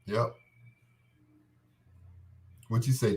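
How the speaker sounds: ordinary talk, nothing marked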